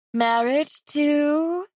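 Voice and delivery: slow teasing prompt; prodding. Acted. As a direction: draw the question out slowly. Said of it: "she prodded".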